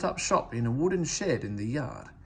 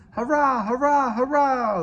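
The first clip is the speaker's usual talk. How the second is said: high, loud jeering cheer; rowdy trucks' triumphant hurrah; mocking trucks cheering; 12 semitones higher, 8 dB louder than usual. A narrator's reading. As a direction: crow it out like gleeful naughty trucks. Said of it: "they laughed".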